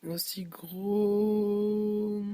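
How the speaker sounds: very slow stretched whisper on "Aussi gros"; unvoiced, drawn-out "Aussi gros". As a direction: breathe "Aussi gros" out slowly.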